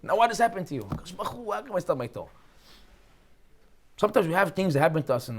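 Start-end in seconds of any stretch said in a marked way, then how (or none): 0.8-2.3 a hushed, half-joking moan to God; mock whiny complaint.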